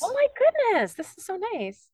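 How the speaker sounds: high, breathless surprise; surprised. Real, not acted. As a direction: caught off guard, delighted. Surprise message announced.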